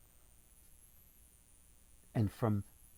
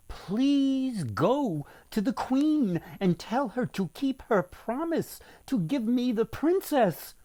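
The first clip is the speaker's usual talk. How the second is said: the crab begs his mother, pitched up; a small creature asking for his promised bride; crab's plea; 10 semitones higher than usual. Acted.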